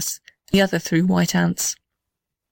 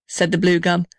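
plain reading voice

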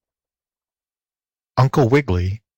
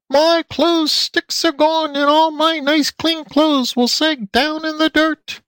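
very high, slow pig squeal; squealing pig lady; slower than usual. A narrator's reading. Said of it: "squealed the pig lady".

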